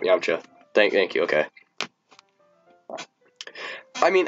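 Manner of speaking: usual speaking voice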